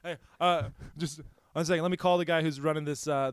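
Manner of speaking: ordinary delivery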